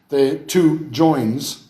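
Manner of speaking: two slowed right down and leaned on; stress on two. Shown as a demonstration. Lecturer driving home the point, very slowly. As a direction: drag out the two joins, weighting two. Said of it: "stressing the contrast".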